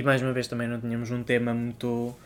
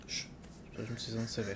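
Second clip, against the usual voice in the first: whispering; 3 semitones lower, 15 dB quieter than usual. Real, not acted. Late-night confession, stifled laugh.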